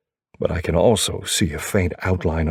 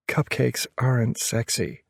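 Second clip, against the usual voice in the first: female character voice; 6 semitones higher, 4 dB quieter than usual. A narrator's reading. Narrator voicing girlfriend's reply.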